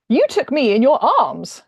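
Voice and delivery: loud, slow accusation. Acted. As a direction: accuse him loudly and slowly. Woman accuses hero loudly.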